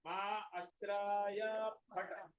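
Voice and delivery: "Ma Astraya Phata" stretched out very slowly, syllable by syllable; slow demo. Shown as a demonstration. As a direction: spell out "Ma Astraya Phata" for students to copy. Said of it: "You have to do like this".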